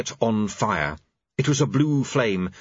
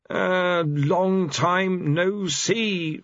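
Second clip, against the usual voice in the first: awkward; 8 semitones higher, much slower than usual. A narrator's reading. Pestilence awkward greeting.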